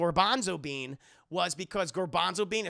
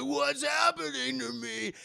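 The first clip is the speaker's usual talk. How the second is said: high, slow mock wail; hammed-up cry of dismay; panicked wail; 7 semitones higher than usual. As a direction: wail it high, drawn out, like a confused monster. Acts villain finding body scrambled.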